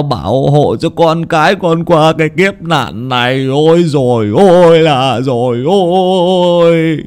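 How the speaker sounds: slow, high tearful pleading to the ancestors; whimpering plea. A narrator's reading. Old Hán kneels begging ancestors to protect son, tearful whimpering plea. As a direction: beg through sobs, drawn out. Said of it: "Ông quỳ xuống mếu máo".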